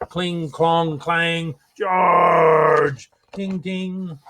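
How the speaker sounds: clanging swords and a shouted charge; battle noises. Acted. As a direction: act out the clash of the battle.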